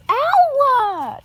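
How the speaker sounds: sharp high loud ow; real yelp of pain. Real, not acted. Girl bitten by her dog while filming: high loud yelp. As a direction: yelp as the teeth catch you.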